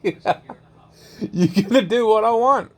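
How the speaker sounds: mock pimp. Pimp telling the woman who's boss, slow and laughing. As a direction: ham up a pimp laying down the law, slowly. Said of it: "being a complete pimp".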